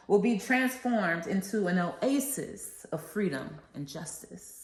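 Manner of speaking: rousing oratory with a preacher's cadence; formal speech. Acted. Student reciting the I Have a Dream speech to listeners, rousing oratory. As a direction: proclaim each line like a civil rights speech to a crowd.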